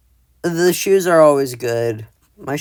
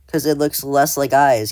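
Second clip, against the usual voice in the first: ordinary talk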